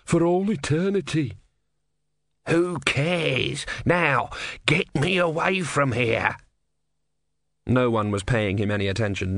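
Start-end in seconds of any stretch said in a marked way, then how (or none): 0.0-1.3 muttered. Acted. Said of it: "he muttered". 2.5-6.4 slow, testy little god voice; impatient tortoise, dragging words; grumpy tortoise god. Acted.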